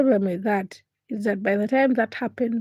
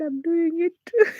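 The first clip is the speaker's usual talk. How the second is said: laughing; 9 semitones higher, 4 dB louder than usual. Real, not acted. Laughing cry retelling breathing.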